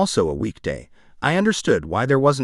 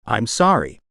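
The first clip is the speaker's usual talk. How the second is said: put-on British accent; slower than usual. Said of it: "he said in the sexiest British accent ever".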